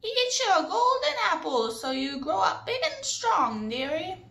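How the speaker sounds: high, loud doddering granny; old granny voice. Retold or imitated. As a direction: croon it like a fussy old grandma. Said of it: "try on my best old granny voice".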